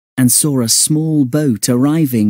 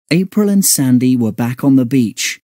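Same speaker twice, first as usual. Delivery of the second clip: ordinary delivery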